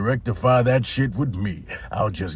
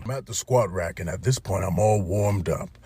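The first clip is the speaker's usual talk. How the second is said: ordinary talk, nothing marked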